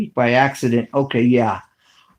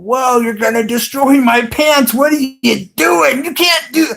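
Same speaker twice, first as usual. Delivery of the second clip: panicked yell; 10 semitones higher than usual. Retold or imitated. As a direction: retell your own alarm, pitched way up and half-shouting. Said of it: "I was freaking out, going".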